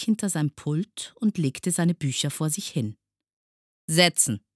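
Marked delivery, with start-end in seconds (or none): 3.9-4.4 firm, louder one-word order; commanding. A narrator's reading. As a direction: order the class curtly. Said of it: "befahl er dann". (befehlend, mit Nachdruck)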